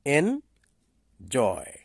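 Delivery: Enjoy drawn out slowly, split as এন-জয়; teacher says enjoy slowly, stressing এন; stress demo. Shown as a demonstration. Teacher models enjoy for pupils, slow and stressed. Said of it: "যেমন".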